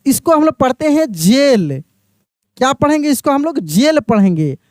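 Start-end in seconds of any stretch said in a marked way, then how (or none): 1.1-1.8 जेल pronounced "jail". Shown as a demonstration. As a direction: say जेल like the English jail, not gaol. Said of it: "याद रखिएगा".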